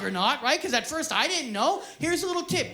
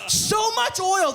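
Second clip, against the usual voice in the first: loud, higher and drawn out; slow comic shout; 8 semitones higher, 6 dB louder, slower than usual. Acted. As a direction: stretch it out in disbelief.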